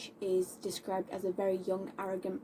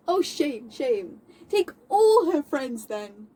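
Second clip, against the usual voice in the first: Moll's indignation; 6 dB louder than usual. Acted. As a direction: challenge him, building to a loud outcry. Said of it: "her describing how she feels about".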